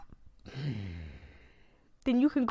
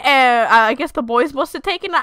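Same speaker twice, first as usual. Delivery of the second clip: ordinary talk, nothing marked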